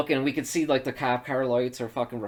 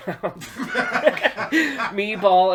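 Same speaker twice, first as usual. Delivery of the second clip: slow, loud words breaking into laughter; laughing at own slip; 10 semitones higher, 8 dB louder, much slower than usual. Real, not acted. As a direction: crack up while correcting yourself.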